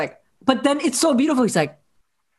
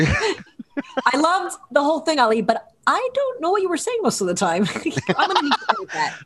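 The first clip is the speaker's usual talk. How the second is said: talking through laughter, pitched up; laughing; 4 semitones higher than usual. Real, not acted. Co-host teasing about not understanding the Spanish, laughing. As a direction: laugh your way through the words.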